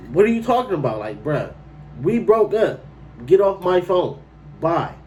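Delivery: high-pitched, exasperated telling-off; worked-up, dismissive retort; indignant brush-off. Retold or imitated. Acts out dismissing ex on phone.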